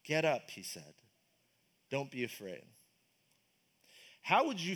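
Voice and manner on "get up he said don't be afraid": softly, lower, to calm the frightened; a hint of Jesus gently comforting; quiet, tender "don't be afraid"; gentle reassurance. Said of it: "he touched them".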